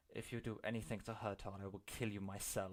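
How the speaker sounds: quick, hushed warning; quiet, protective threat; low menacing threat. Acted. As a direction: say it under your breath, fast. Private threat to Milo in character.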